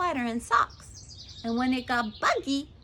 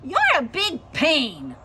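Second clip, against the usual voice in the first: loud, raised angry tiger voice; annoyed tiger; 5 semitones higher, 12 dB louder than usual. A narrator's reading. Woken tiger pounces on the girl, angry and loud. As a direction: roar the insult at her.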